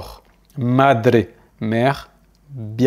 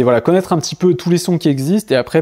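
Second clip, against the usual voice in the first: ordinary delivery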